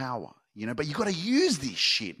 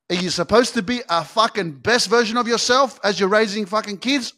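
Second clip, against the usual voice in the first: ordinary delivery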